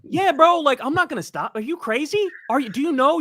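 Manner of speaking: shouting down his critics, incredulous and fired up; fired-up defiance. Real, not acted. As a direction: blast back at them, fired up.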